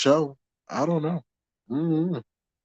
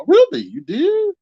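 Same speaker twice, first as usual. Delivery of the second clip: 1 surprised; 14 semitones higher than usual. Real, not acted. Surprised reaction to chat.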